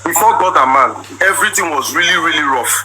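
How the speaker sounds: ordinary talk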